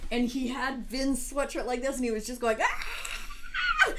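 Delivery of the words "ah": very high angry baby shriek, imitated; piercing mock baby yell; imitated baby scream. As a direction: mimic a six-month-old screaming angrily. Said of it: "just yelling at him like that".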